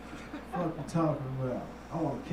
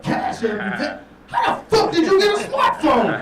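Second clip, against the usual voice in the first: climbing to a high loud shout; shocked disbelief; 11 semitones higher, 11 dB louder than usual. Retold or imitated. Comic yelling at the hobo with a smartphone, mock outrage.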